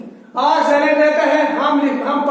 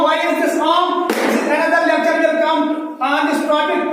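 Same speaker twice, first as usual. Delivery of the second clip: ordinary talk, nothing marked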